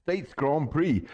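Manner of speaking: high, drawn-out announcer welcome; event announcer. Real, not acted. Host welcomes viewers to the Grand Prix, announcer style. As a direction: stretch out the big race welcome. Opens the race broadcast.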